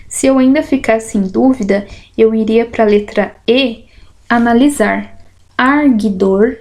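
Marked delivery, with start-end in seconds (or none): none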